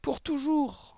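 high-pitched desperate exclamation; distressed cry. A narrator's reading. Girl correcting teacher's idea of a holiday, distressed cry. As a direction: cry it out as if the world is ending. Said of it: "s'écria Mathilda".

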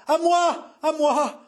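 high frantic cry for help; delirious howl. A narrator's reading. Mother calling her husband to her aid, delirious howl. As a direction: scream for rescue, high and frenzied. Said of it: "hurla Jeanne, délirante".

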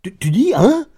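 stammering, rising into a loud high hein; stupefied. A narrator's reading. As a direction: baron thrown off by his servant's request, astonished stammer. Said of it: "stupéfait".

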